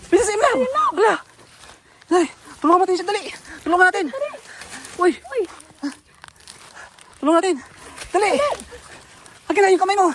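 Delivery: high loud urgent yells; frantic shouting. Real, not acted. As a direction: shout to save the friend. Rescuing friend, frantic shouting. (sigaw ng pagsagip)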